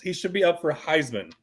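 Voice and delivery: ordinary talk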